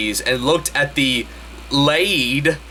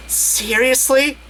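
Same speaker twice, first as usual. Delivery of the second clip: high incredulous squeak; 10 semitones higher, slower than usual. Acted. Lala asking, far voice.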